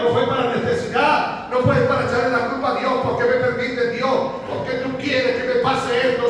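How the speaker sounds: loud, rising sermon voice, hammering each line; fiery preaching. Acted. Preacher to congregation about Paul, full-force fervent preaching. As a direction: pastor preaching Paul's praise in the cell, full force.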